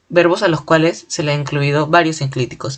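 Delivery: ordinary talk, nothing marked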